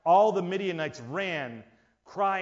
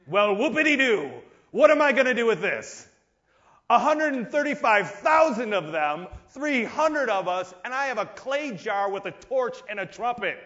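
sarcastic soldier; 5 semitones higher, 6 dB louder than usual. Acted. Soldier voiced complaining about weapons.

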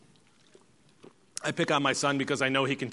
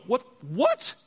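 mock outraged double-take, second one pitched up; imitated incredulous reaction; upset disbelief; 8 semitones higher than usual. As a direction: act out someone indignantly caught off guard. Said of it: "He'd be upset".

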